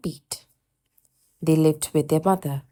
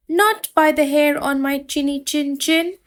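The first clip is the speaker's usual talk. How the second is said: higher squeaky little pig cry; piggy cry; 8 semitones higher than usual. Acted. Frightened piglet crying out to the wolf, higher voice. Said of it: "cried Elmer".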